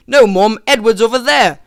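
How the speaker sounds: mock-British exasperation; British accent. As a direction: object as a fed-up British child. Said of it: "pioneers had British accents in that scene".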